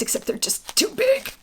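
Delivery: high breathy whisper under the breath; whispered aside. Real, not acted. Reviewer whispering that the earrings are too big. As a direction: let it slip out as a secret aside.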